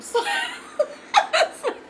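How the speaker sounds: high squealing laugh. Real, not acted. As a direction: burst into a high-pitched laugh. Laughs after retelling the pillow hit.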